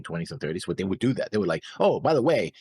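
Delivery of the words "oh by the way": normal talking voice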